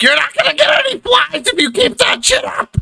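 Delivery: very high-pitched scolding yell; shouting from inside. Acted. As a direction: holler a threat at a misbehaving kid. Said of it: "It's like".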